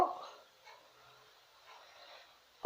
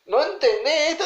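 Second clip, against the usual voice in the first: mock rebuke; 9 semitones higher than usual. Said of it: "le dice".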